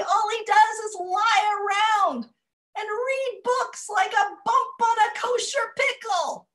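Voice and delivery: put-on loud, high complaining old woman; grumpy old woman. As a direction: kvetch loudly like a grouchy grandmother. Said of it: "I get to be very grumpy".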